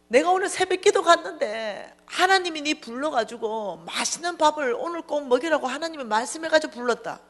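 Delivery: high-pitched pleading explanation; acted-out insistent appeal to someone leaving; insistent explaining. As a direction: voice the friend protesting in a high pitch. Friend pleads, calling across distance.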